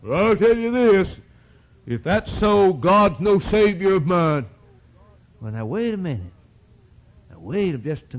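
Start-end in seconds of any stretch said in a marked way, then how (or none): 0.0-4.5 loud, acted defiant outburst; indignant mock protest, slowing at the end; angry retort. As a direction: play an offended man's angry refusal. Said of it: "They get mad".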